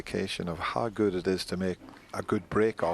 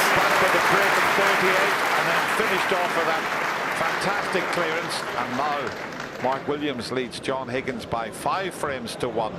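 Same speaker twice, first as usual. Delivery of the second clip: loud, high thrilled play-by-play; excited commentary; 9 semitones higher, 9 dB louder than usual. Real, not acted. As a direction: lift it up, thrilled by the clearance. Commentator hails fantastic clearance.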